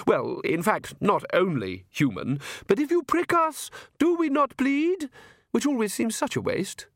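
high-pitched aristocratic lady, airily amused; haughty vampire countess. Acted. Countess musing to guests on spilt blood, airily haughty. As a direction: lilt it high like a grand countess.

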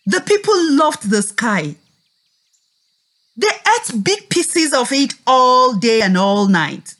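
normal talking voice

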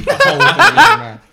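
laughing out loud. Real, not acted. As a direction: blurt it through laughter. Joking about stuffing the pie.

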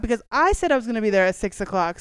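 normal talking voice

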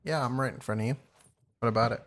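usual speaking voice

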